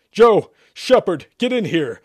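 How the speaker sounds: loud, high calling out; urgent order. A narrator's reading. Exorcist summoning helpers to the room, urgent shout. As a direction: yell for backup. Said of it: "Sherman ordered".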